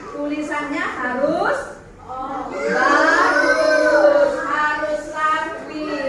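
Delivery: drawn out slowly, words pressed one by one, with a laugh; slow emphatic reminder. Real, not acted. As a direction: stretch each word to insist on neat writing. Teacher stresses neat handwriting. (pelan dan ditekan sambil tertawa)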